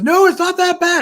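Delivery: high, loud mock protest; acted protest. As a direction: retell her insisting, voice jumping up high. Acted protest in retold scene.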